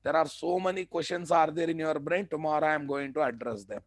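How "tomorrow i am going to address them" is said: usual speaking voice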